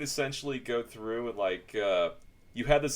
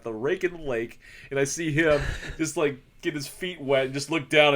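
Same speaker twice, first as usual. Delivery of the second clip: ordinary talk, nothing marked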